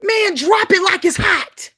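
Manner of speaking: slow, loud, high shout; shouted punchline. Real, not acted. Preacher shouting to the congregation, slow and loud. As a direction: belt it out to the crowd.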